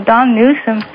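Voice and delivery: usual speaking voice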